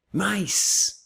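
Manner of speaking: MAIS with a long final S; S final prolongado. Shown as a demonstration. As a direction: let the S at the end of "Mais" ring on. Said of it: "mais acaba com S". ("Mais" com o S final esticado)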